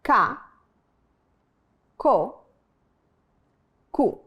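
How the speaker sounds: "Ca, co, cu" each with a hard k and pure vowel; hard Spanish C. Shown as a demonstration. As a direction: tap out "Ca", "co", "cu" one by one. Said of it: "Repeat after me".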